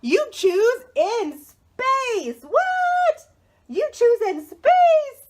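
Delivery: high, loud, slow reveal; hammed-up shock at the sequel; mock amazement. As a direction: build up the big reveal with gasping surprise. Unveiling the new title.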